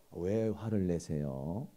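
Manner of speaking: low, slow, soothing question; gentle concern. Acted. Husband answers an angry wife, gentle and slow. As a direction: ask it calmly to defuse anger. Models calm reply to anger.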